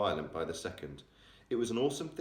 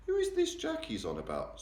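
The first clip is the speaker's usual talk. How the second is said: usual speaking voice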